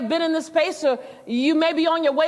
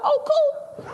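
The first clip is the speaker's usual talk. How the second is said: much higher mock-bright "Oh, cool."; sarcastic cool; 13 semitones higher than usual. Teen answers the judge with mock-bright sarcasm. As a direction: fake delight at missing the race. Sarcastic reply to missed heat.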